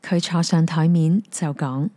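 usual speaking voice

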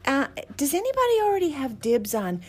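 high, overly polite message voice; sweetly casual. Retold or imitated. Messaging the rescuer, high and sweetly casual. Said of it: "I go".

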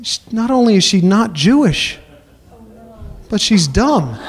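normal talking voice

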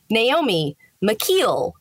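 Naomi McKeel, slow, mick-KEEL; name option two. Shown as a demonstration. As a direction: stretch out McKeel with a long ee. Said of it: "one will be like".

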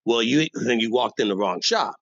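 ordinary delivery

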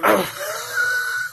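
high shrill shriek of fright; retold frightened shriek. Retelling the scare to friends, a high shriek. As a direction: shriek like something scared you. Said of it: "me in the background just going".

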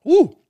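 high, loud whoop at the heat. Real, not acted. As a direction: let out a big woo. Reacting to the hot wing.

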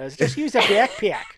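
mock Chicago accent with a laugh; imitating Chicago accent. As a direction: exaggerate the Chicago A in backpack. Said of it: "in the most Chicago way".

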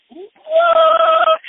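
very high, loud mock shriek of shock; astonished shout. Storyteller re-enacting learning it really was his idol, astonished shout. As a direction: shriek it, mind completely blown.

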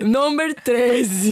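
very slow, stretched announcer call; mock announcer. Co-host repeats the episode number in a hammed-up announcer voice. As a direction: drag out the number like a show host.

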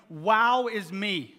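loud, stretched-out WOW; mock self-admiration. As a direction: crow "Wow" slowly, as parody of the lament. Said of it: "doesn't respond to that by saying".

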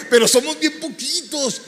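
high, loud and drawn out, half alarmed; retold wife's loud, slow protest; loud worried protest. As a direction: push it up and out, slowly, like a worried objection. Wife objecting to the burrito plan.

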